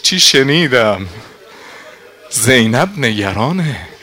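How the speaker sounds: loud, anguished telling; urgent outcry. Acted. Nafe telling Habib the news, loud and urgent.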